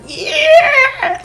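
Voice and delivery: very high shrieking cry; monster shriek. Retold or imitated. Nomu shocked by the orbs, high shriek. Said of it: "He kind of goes like".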